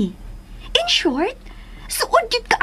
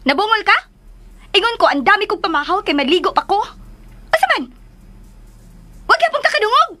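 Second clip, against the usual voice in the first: irritated scolding; 5 semitones higher, faster than usual. Acted. Scolding housemate about hearing.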